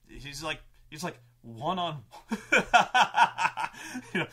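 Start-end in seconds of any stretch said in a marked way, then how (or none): 1.4-4.3 big staccato villain's cackle breaking up the words; villain laughing. Acted. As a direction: burst into loud, high mocking laughter.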